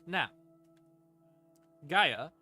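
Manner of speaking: ordinary delivery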